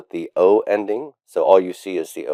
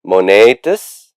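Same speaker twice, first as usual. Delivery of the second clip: "Monetis" slow and drawn out, rising at the end; recitation for repetition; 4 dB louder, slower than usual. Shown as a demonstration. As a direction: model the word for a class to echo. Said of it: "You can repeat after me".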